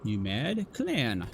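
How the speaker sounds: low, slow drawn-out team name; announcer drawl. Acted. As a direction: draw it out like a ring announcer. Announcer prediction hype.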